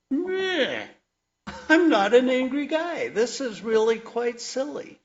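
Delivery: high, loud, huffy protest; mock indignant denial. Denying anger to his shrink.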